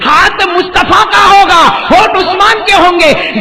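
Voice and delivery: high, loud, ringing proclamation; full-force preaching. Real, not acted. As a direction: cry it out high over the crowd. Same words, low then shouted.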